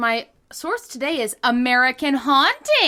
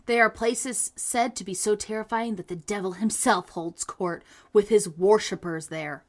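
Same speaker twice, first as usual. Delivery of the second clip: story narration; 3 semitones lower than usual. Acted. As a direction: read it like a spooky tale. Reads ghost story aloud.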